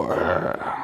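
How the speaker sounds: low breathy monster snarl, no voice; rage monster growl. Pretend. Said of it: "full-on rage monster mode".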